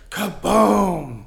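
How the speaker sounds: loud mock explosion; high loud boom effect; kaboom. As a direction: belt out a blast sound. Explosion sound in war story.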